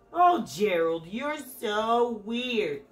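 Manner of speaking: loud, slow mocking taunt; sneering. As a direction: jeer at him like a bully. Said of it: "the animals all sneered".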